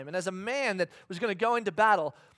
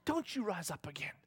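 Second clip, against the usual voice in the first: whisper; 6 dB quieter than usual. Retold or imitated. Scolding Israelites, mock.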